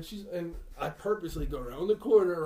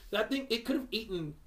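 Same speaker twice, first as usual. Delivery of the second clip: ordinary talk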